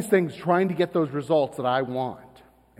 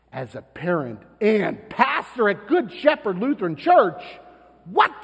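normal talking voice

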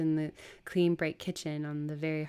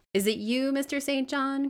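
high, loud anxious call; crying out; 10 semitones higher, 7 dB louder than usual. Acted. Servant calling to the late master, crying out. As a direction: call urgently through the door. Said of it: "cried Hannah".

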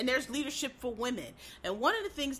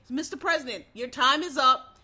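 firm moderator; 5 dB louder than usual. Shown as a demonstration. Moderator firmly cutting off the vice president. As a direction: shut him down with authority. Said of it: "What you need to say is".

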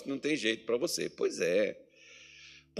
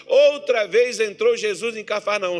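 higher, louder preaching push; fervent preaching; 8 semitones higher, 8 dB louder than usual. Acted. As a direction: drive the point home from the pulpit. Preaching builds up. (pregação fervorosa)

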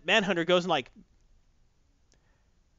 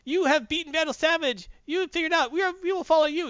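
worshipping cavemen; 13 semitones higher than usual. Retold or imitated. Neanderthals hailing Lex Luthor as their new leader, high and wailing. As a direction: cry out to him like grateful followers. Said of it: "the Neanderthals say".

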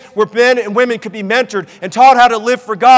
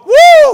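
high, loud roller-coaster "woo"; thrill-ride whoop; 8 dB louder than usual. Shown as a demonstration. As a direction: let out a mock whoop as if on a ride. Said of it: "when you go like that".

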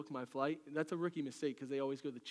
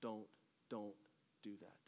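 much softer, lower repeated "don't"; hushed warning; 7 semitones lower, 12 dB quieter than usual. Acted. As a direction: fade the warning almost to nothing. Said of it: "James is saying".

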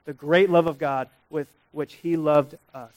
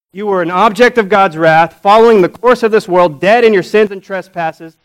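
raised, ringing preacher's call; louder, higher preaching voice; full-force preaching; 4 semitones higher, 11 dB louder than usual. Acted. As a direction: drive it home to the congregation.

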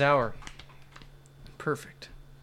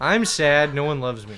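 ordinary talk, nothing marked

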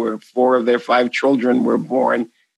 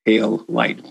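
Boston accent, pale light soft; natural Boston accent drawl; 4 semitones lower, 3 dB quieter than usual. Real, not acted. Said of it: "pardon my Boston accent".

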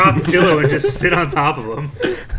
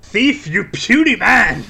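slow, high, hammed-up insult; mock taunting shout; 8 semitones higher, 5 dB louder, much slower than usual. Player taunting a puny man, slow mock shout. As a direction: call it out like a game villain.

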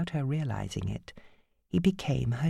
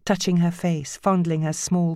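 ordinary delivery, nothing marked

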